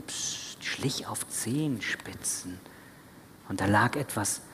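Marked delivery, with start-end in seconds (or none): 0.0-2.6 very soft, slow near-whisper; hushed tiptoe. A narrator's reading. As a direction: narrate as if creeping past a sleeping baby. Father hushes, tiptoeing to baby.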